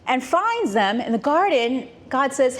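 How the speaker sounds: normal talking voice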